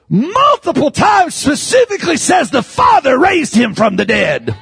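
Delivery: high-pitched, shouted sermon delivery; full-force preaching. Real, not acted. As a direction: drive each phrase out like a revival preacher.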